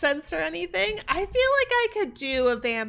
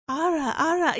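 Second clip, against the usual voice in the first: higher, louder attempt at an anime-style "Ara, Ara"; raised-pitch practice take; 4 semitones higher, 4 dB louder than usual. Shown as a demonstration. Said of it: "I have to get, like, a deeper".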